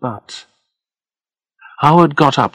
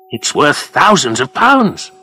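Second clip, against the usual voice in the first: drawn-out, thrilled emphasis; excited; 5 semitones higher, 5 dB louder than usual. A narrator's reading. Freddie sells Howard on the jade dragon, excited. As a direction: relish the figure, slow, high and loud. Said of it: "He was excited now".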